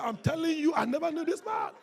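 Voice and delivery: high, shouted acted denial; pressured denial. Peter denies knowing Jesus to his accuser, shouted protest. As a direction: shout it insistently, pitched well up. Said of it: "when they're under pressure".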